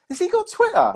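host mimics Nadine's question in a high silly voice; mock partner voice. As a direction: play his partner as clueless. Partner's clueless reply retold.

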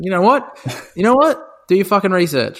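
high, loud mock rant; indignant high-pitched rant; mock outburst. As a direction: snap it at an imagined critic.

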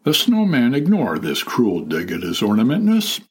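ordinary delivery, nothing marked

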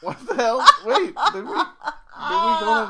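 laughing in disbelief; cracking up mid-question. Real, not acted. Laughing at a mom joke.

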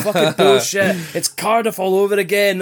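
high, loud outraged fan; mock-furious heckling; imitated crowd shouting. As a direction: rant like a disgusted fan in the stands. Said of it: "people are shouting".

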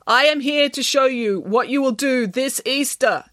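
high, ghostly intoning; intoned. A narrator's reading. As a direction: intone it like a solemn spirit. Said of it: "intoned Michael".

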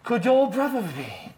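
hammed-up Aussie-ish accent on every line; lightly put-on antipodean accent, threatening the host; weird put-on accent. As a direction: play Coco the tough Yeti, mock menacing. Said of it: "that's a weird fucking accent".

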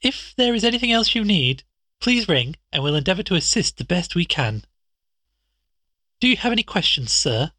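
high, courteous hotel clerk voice; a smooth professional hostess; polite receptionist. Acted. Hotel receptionist speaks to guest.

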